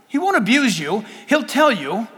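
usual speaking voice

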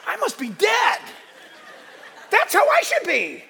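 high, loud, hammed-up inner voice; mock self-judgment. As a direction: yell your old worried thought, laughing. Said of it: "I was judging myself thinking".

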